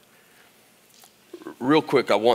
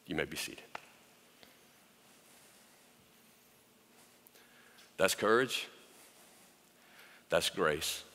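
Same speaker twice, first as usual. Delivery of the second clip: soft, hushed, tender preaching, much softer; quiet tender sermon; 5 semitones lower, 3 dB quieter than usual. Acted. Preacher softly reassuring hurting women, hushed and tender. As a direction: speak gently to the women who stood up.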